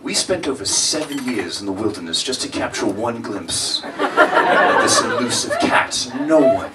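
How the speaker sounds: soft, slow nature-doc narration; hushed documentary narrator. Retold or imitated. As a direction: whisper it like a wildlife narrator hiding from the cat. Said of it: "the narrator would be like".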